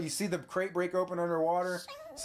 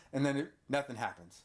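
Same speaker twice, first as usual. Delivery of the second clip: normal talking voice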